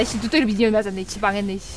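low, soft grumble; sinking, defeated undertone; deflated muttering. Real, not acted. Skill missed after excited plan.

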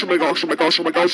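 ordinary delivery

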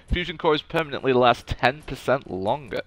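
ordinary delivery

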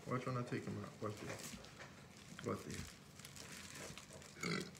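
hushed build-up before the reveal; whisper. Real, not acted. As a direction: breathe it to the viewers like a secret. Reveals the folded food.

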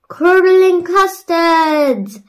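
high, loud, slow shocked cry; gasped exclamation. A narrator's reading. Peter seeing the Yeti burst in, shocked cry. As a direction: gasp it out in alarm.

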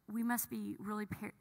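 ordinary talk, nothing marked

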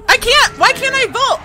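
high, loud frustrated yell; frustrated shout. Real, not acted. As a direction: yell at the game. Frustrated in chase.

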